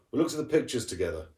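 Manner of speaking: ordinary delivery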